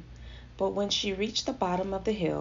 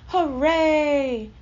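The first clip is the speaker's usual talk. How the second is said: high, loud drawn-out cheer; cheering shout; 7 semitones higher, 8 dB louder than usual. A narrator's reading. Animals cheering the rescue, joyful shout. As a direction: shout it out in celebration. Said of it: "everyone shouted".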